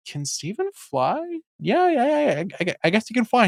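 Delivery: lightly voiced Amethyst, dopey and unbothered; breezy mock Amethyst, high on the yeahs; imitating Amethyst. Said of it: "Amethyst is like".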